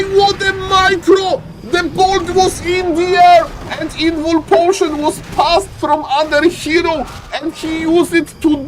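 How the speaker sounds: high, fast, excited shouting play-by-play; frantic sports commentary. Real, not acted. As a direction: scream the highlight like a goal call. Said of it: "I got too excited".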